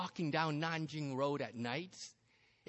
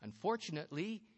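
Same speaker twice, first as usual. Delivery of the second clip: plain reading voice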